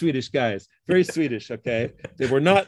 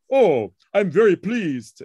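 slow, louder imitation of a German accent; Gerd Smolka's German accent; 3 semitones higher, 5 dB louder, slower than usual. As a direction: draw it out with German-accented delight. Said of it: "in his German accent".

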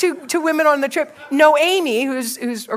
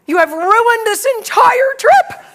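high, slow, emphatic complaint; mock outrage; 8 semitones higher, 4 dB louder, slower than usual. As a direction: imitate a friend's drawn-out scolding. Jessica scolds the teller.

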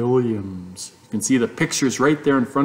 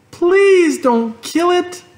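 very high, slow, louder pleading; sobbing plea; 5 dB louder, slower than usual. A narrator's reading. Fern begs her father for the pig, sobbing. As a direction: beg through sobs. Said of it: "she sobbed".

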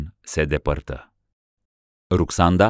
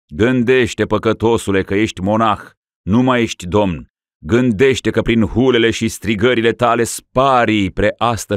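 stern admonition; 5 semitones higher, 4 dB louder than usual. Acted. Metropolitan admonishes the dying ruler.

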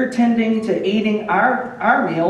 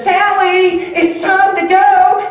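high, loud sing-song mother calling; a mother singing out her child's name; high sing-song call to a daughter at the playground; sing-song mom call; 10 semitones higher, 6 dB louder, slower than usual. Retold or imitated.

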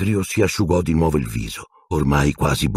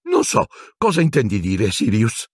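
stammering; 6 semitones higher than usual. A narrator's reading. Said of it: "balbettò Pettigrew".

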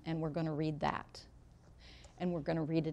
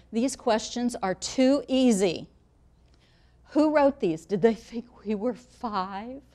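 higher, louder and slow indignant grumble, too stressed and drawn out; mock complaining student; 4 semitones higher, 7 dB louder, slower than usual. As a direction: play a student scoffing that the questions are childish. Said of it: "Someone will say".

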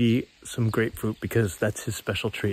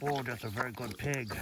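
softer murmured praise for the pig; gentle, hushed good-pig praise; 2 semitones lower, 10 dB quieter than usual. Real, not acted.

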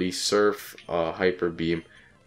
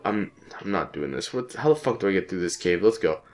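ordinary talk, nothing marked

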